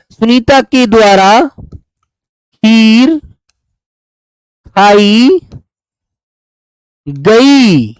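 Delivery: drawn out word by word, very slow; very slow dictation. Shown as a demonstration. Teacher dictating the correct sentence to students, very slow. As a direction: spell it out slowly so students can copy. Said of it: "समझ लो छोटी सी बात".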